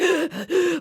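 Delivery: high, wheezy imitated gasp; mock asthma gasp. Demon posing as a kid fakes asthma, with a high mock gasp. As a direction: fake a struggle for air. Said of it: "he started an asthma attack".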